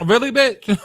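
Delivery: loud, high-pitched disbelief; incredulous. Real, not acted. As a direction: call out in exasperated disbelief. Reacting to clip in disbelief.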